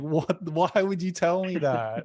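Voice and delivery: hammed-up incredulous complaint; exasperated disbelief. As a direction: act out an aggrieved "why?", stretched slow. Retells reaction to being spoiled.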